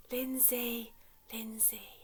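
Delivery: from plain to a whisper; name fading to a whisper. Shown as a demonstration. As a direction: let the name fade to a whisper. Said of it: "quieter and quieter".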